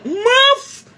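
high, loud mocking scoff; a sharp high scoff at the critics; high-pitched dismissive mouf. Real, not acted. Scoffing exclamation at commenters.

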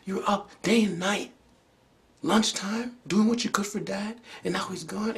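high, loud anguished plea; pleading outburst. Retold or imitated. Son confronts his grieving mother, loud and pleading. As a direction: let it all out at her, begging.

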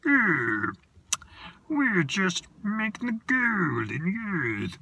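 high, slow creature voice; high and drawn out like a tiny gold creature; Snoswatch creature voice. Acted. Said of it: "And he talked like this".

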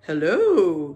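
loud, drawn-out hello with a swoop; a hint of an old sheep; old sheep greeting. A narrator's reading.

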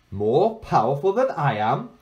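mocking, rising disbelief; amused girl. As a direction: scoff like a child.

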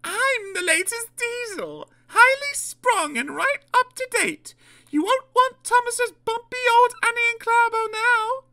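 very high, showy diesel voice; boastful Daisy. Acted. Daisy selling herself to the waiting passengers, boastful. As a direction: brag in a high put-on voice.

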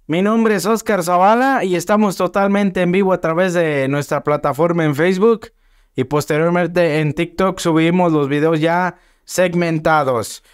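normal talking voice

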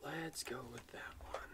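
voiceless whisper to himself; whispered aside. Real, not acted. Muttered decision aside.

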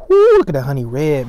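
usual speaking voice

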